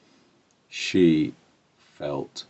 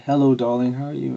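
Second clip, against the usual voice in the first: higher, sing-out motherly hello; cheerful mum greeting; 8 semitones higher, 4 dB louder, faster than usual. Retold or imitated. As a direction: greet your child fondly in a raised pitch. Mum greeting her child at door.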